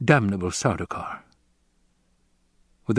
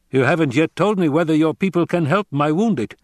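put-on raised, tense voice of a tired old soldier; weary commander Hawat; 7 semitones higher, 4 dB louder than usual. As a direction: press the stranger for help.

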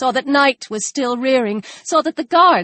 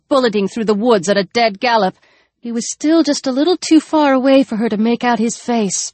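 usual speaking voice

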